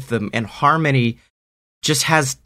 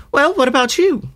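put-on high girl voice; 13 semitones higher than usual. Harmony asks Greg.

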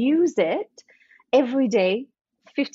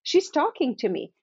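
higher, bright-eyed mock assistant voice; chirpy inner personal assistant; 6 semitones higher than usual. Coach voices a client's inner personal assistant, chirpy and higher. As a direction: play the eager helper ticking off a list. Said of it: "your personal assistant is like".

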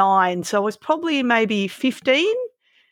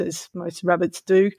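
ordinary talk

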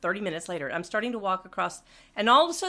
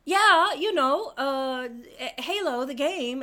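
higher, slow, eager explaining; 3 semitones higher, slower than usual. Retold or imitated. A gamer explaining her Halo shirt to a church stranger, eager. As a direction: spell out the game for him, patiently. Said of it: "And I said".

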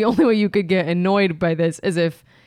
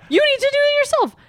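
mock urging.

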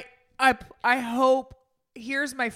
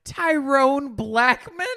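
ordinary delivery

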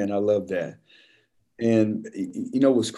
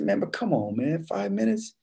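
pleading; 5 semitones higher, 5 dB quieter than usual. Retold or imitated. Begging the cook again.

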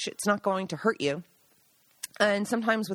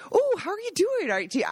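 much higher, bright chatty put-on voice; husband chatting up silent mushroom hunters in a much higher put-on voice; chipper greeting; 6 semitones higher than usual. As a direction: act too friendly with wary strangers. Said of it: "and he'd say".

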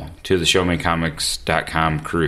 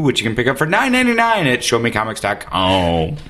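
ordinary talk

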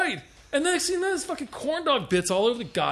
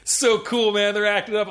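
ordinary talk

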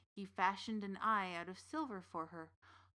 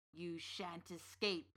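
menacing witch; 3 semitones lower, 4 dB quieter, slower than usual. Acted. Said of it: "she said".

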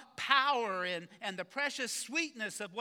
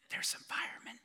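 put-on small boy whispering into the phone; whispering child; 5 dB quieter than usual. Little Jimmy answering the pastor's call, whispering. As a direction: breathe it like a hiding four-year-old. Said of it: "a voice answered with a whisper".

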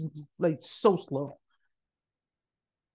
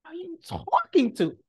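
high-pitched incredulous question; disbelief; 10 semitones higher than usual. Retold or imitated. Student reacting to the professor's welcome, high disbelief. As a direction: ask it high, as if it can't be you.

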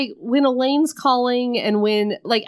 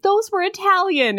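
high indignant squeak; way up, offended about her shoes; high mock heroine; 12 semitones higher than usual. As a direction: shoes were ruined, mock heroine protests. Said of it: "she's like".

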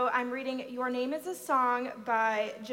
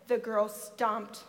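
usual speaking voice

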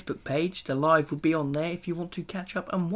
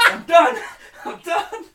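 high-pitched words through real laughter; laughing too hard; 12 semitones higher, 8 dB louder than usual. Real, not acted. Co-host breaking down over the penguin answer, laughing. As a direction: give up, helpless with laughter.

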